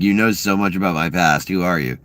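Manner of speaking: ordinary talk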